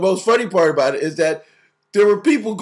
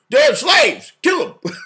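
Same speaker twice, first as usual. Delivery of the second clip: high, loud mock slaver's cry; hammed-up high shouted order; 7 semitones higher, 4 dB louder, much slower than usual. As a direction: bark it out as a cruel slaver, higher and louder.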